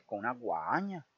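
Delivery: ordinary delivery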